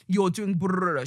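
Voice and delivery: blubbering mumble noise; mock flustered brrrr. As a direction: sputter it out like flustered lips. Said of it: "but I'm saying like that".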